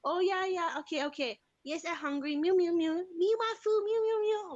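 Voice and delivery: put-on high child voice mixing talk and meows; kid playing cat. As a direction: beg for food like a cat. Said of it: "she'll be like".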